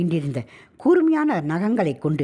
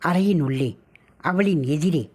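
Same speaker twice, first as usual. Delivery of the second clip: plain reading voice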